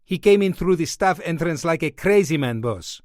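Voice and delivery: put-on excited doorman in a heavy Greek accent. A narrator's reading. Greek doorman complains to his boss, heavy Greek accent. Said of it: "spoke with a heavy Greek accent".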